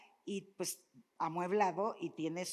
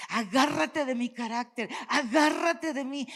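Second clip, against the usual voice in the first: loud, rising preaching plea; urgent preacher's cry; fervent exhortation; 4 semitones higher, 8 dB louder than usual. Real, not acted. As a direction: call them to hold on, loud and higher.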